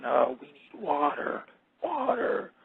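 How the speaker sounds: slow, soft, crying plea for water; thirsty plants moaning softly; parched plants pleading. Pretend. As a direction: play a dying plant begging for water, whimpering. Wilted plants voiced begging.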